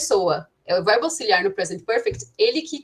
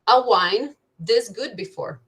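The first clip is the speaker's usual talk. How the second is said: slow, deliberate example sentence; teacher modelling an English sentence slowly; slow demo; much slower than usual. Shown as a demonstration. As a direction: lay out each word slowly for the class. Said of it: "Você poderia dizer assim".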